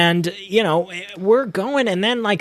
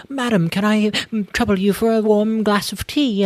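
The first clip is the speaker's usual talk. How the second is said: higher, drawn-out mock British accent; British period-drama accent; 3 semitones higher than usual. Film character requesting tea from madam, mock British accent. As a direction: play a proper Regency Brit, stretching the words. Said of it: "when I'm doing the impressions".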